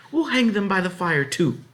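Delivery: high, loud commanding woman's voice; stern farm wife ordering. A narrator's reading. Farmer's wife orders the girl to strip off wet breeches, commanding.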